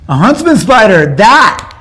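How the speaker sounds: loud, high burst of approval; excited exclamation. Real, not acted. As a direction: pounce on the answer, punching it out. Seizes on the better animal answer.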